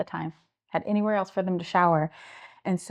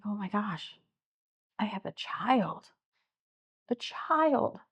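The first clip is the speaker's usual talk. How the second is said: stunned, hushed; 4 semitones higher, 6 dB quieter, slower than usual. Real, not acted. Shocked realisation she is a child.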